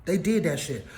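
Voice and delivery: ordinary talk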